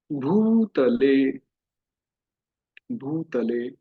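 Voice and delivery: "भूतले" drawn out very slowly, each syllable held; slow demonstration. Shown as a demonstration. Teacher slowly models "भूतले" for the students, very slowly.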